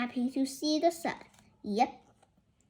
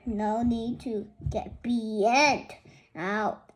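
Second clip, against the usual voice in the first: plain reading voice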